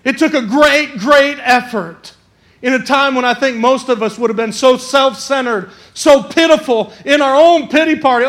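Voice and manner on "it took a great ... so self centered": usual speaking voice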